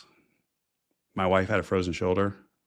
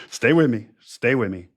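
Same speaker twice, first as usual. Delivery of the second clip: loud call; 4 semitones higher, 7 dB louder than usual. Real, not acted. Rallying listeners mid explanation.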